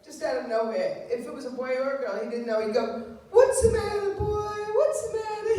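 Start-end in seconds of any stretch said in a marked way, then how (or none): 3.3-5.6 high, sing-song doggy talk; imitating dad's high dog voice; father's high voice. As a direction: coo at the dog like dad did. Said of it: "He'd use his high voice".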